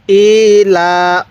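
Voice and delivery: very slow "i-la" with held vowels; one-alif stretch. Shown as a demonstration. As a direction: lengthen both "i" and "la". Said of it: "এক আলিফ টেনে পড়ব".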